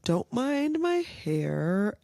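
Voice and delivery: ordinary delivery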